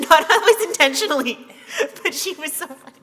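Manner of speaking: speaking while laughing, loud burst; laughing through words. Real, not acted. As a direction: let the laugh break through the line. Recalling funny friend at funeral.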